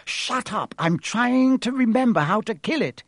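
put-on girl snapping while thinking. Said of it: "said Hermione".

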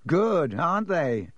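slow, silky gloat; slow, smooth taunt. A narrator's reading. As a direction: purr it out, pleased with himself. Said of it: "said Malfoy smoothly".

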